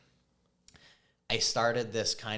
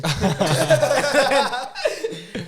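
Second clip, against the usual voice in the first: big high laugh; real laughing fit; loud burst of laughter; 12 dB louder than usual. Real, not acted. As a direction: crack up loudly. Laughing at Zac Efron story.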